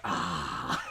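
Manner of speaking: flash them and crack up; playful gremlin gasp; mock creature gasp. Gremlin flashes, then laughs.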